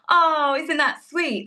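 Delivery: cooing in imitation of a touched crowd; collective cooing. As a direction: imitate an audience going aww, drawn out. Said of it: "everybody collectively goes".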